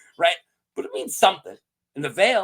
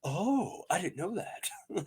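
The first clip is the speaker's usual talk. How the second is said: softer whispered fake surprise; mock innocent whisper; 12 dB quieter, slower than usual. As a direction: feign innocence under your breath. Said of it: "He's like".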